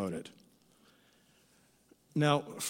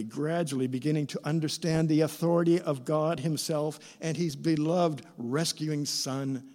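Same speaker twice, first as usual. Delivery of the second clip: ordinary talk, nothing marked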